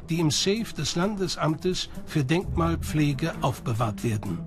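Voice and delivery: normal talking voice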